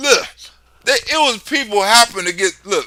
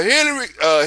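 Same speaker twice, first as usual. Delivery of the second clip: ordinary delivery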